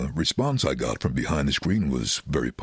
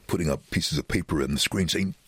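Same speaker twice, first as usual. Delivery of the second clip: usual speaking voice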